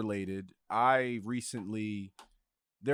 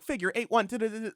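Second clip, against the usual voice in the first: high-pitched mock robot reader; automated voice; 12 semitones higher, 3 dB louder, faster than usual. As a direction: imitate a text-to-speech app reading captions blindly. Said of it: "because it's an automated voice".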